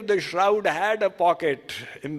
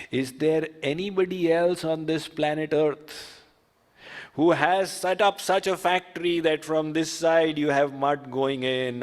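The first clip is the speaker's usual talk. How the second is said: ordinary talk, nothing marked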